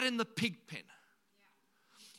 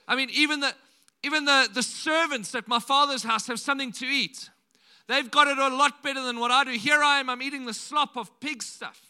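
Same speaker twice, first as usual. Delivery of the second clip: 1 prodigal son's lament; 3 semitones higher, 8 dB louder than usual. Retold or imitated. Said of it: "He says".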